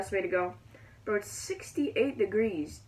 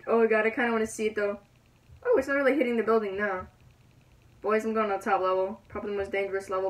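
ordinary talk, nothing marked

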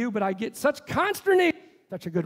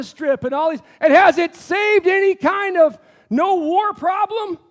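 loud, rising preacher's call to the crowd; preaching at full force; 5 semitones higher, 8 dB louder than usual. Acted. The pastor rouses the congregation about borders and Israel, loud and fervent. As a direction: build it up and hammer each line home.

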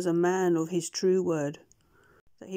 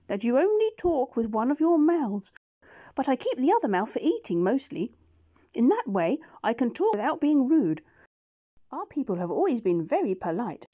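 much higher, shy creature voice; gentle beast agreeing; 5 semitones higher, 4 dB louder than usual. Acted. Push-Me-Pull-You agrees to go with the doctor, high shy voice. Two-headed beast explains politely.

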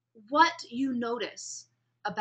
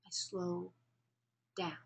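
drawn-out and softer; slow; 4 semitones lower, 10 dB quieter, slower than usual. Shown as a demonstration. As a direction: stretch each word to show slowing down. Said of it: "I don't want to speak this slowly".

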